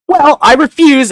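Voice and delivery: high, loud, mock-prim refusal; smug refusal. Quincy on the bed refuses to leave, mock smug. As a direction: refuse with hammed-up smugness. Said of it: "the guy's like".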